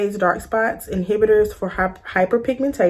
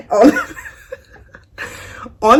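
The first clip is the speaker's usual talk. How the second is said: laughing; 10 semitones higher than usual. Real, not acted. Laughing at her own bar name.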